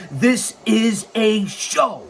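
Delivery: each word of "This is a show." slow and punched; emphatic declaration. Real, not acted. Host insisting it is no podcast, "This is a show." slow and emphatic.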